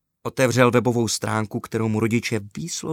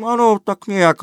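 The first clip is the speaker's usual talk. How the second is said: cheeky agreement; 10 semitones higher, 8 dB louder than usual. A narrator's reading. Boy answers mom, secretly winking.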